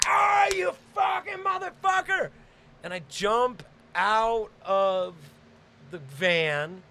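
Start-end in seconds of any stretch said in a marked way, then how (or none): 0.1-2.3 high, strained furious shriek; acted high-pitched outrage; enraged yell. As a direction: yell it mid-fight, pitched way up. Acted rage while punching his friend.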